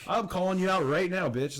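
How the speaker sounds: high, loud call-out. Real, not acted. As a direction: shout the challenge straight at him. Calling him out on pod.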